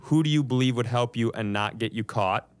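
ordinary talk, nothing marked

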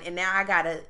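usual speaking voice

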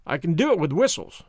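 ordinary delivery, nothing marked

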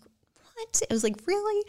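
high, whispered gasps of surprise; hushed disbelief. Retold or imitated. Recalling her shock at the proposal, high and hushed. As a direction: squeak it out in quiet shock.

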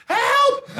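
high, loud, laughing yelp; mock cry for help. Comic stuck in the bathroom calling for help, high and loud. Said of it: "I was like".

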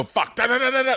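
high-pitched joking rat-a-tat; imitated gunfire. Comic joking about shooters who unload their gun, high mock gunfire. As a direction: mimic a trigger-happy shooter in a high voice.

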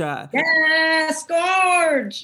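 high, drawn-out fawning cheer; hammed-up diva compliment; drag queen gush. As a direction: gush it like a beauty influencer. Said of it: "a drag queen or, like, a beauty influencer".

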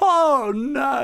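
surprised. Real, not acted. Reacting to the story.

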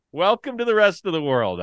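loud high taunt, leaning on the; the repeated taunt, still loud; gloating shout again. Real, not acted. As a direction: rub it in a second time.

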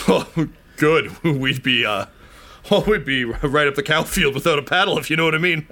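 relieved, chuckle it off; nervous farmer, laughing. Acted. Farmer relieved it's not a health inspector, with a nervous laugh.

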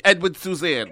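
high, loud comic mother voice; Italian mom. Acted. Mom answering her son's movie question, comic Italian mom. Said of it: "She'll be like".

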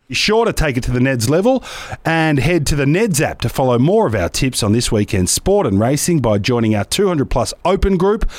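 loud, punchy sponsor read; host reading betting sponsor ad, loud. Acted. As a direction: sell the bet, big and bold. Reads sponsor ad.

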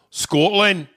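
loud, high Scottish imitation; mock Scottish accent. Repeats country in mock local accent.

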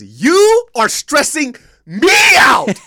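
high, slow, shouted wail; mock shriek. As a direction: wail it like a melodramatic mom. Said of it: "She says".

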